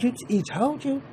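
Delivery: hammed-up shocked reply; high mock disbelief. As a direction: sound caught off guard. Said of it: "He said".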